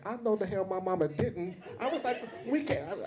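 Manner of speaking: high, disbelieving laugh; can't believe what mama did; incredulous. Retold or imitated. Daughter hearing her mother invited the hosts, high disbelieving laughter.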